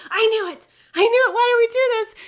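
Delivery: high, loud retold indignation; exasperated outburst. Rider learning the ice was melted, exasperated. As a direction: cry out, vindicated and upset.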